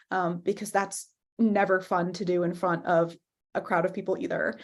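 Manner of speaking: ordinary talk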